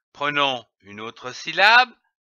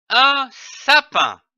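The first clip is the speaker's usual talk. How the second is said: "un sapin" said slowly and high, stress on "un"; "un sapin", lentement, en appuyant sur "un"; 7 semitones higher, 4 dB louder, slower than usual. Shown as a demonstration. ("un sapin" dit lentement, voix plus haute)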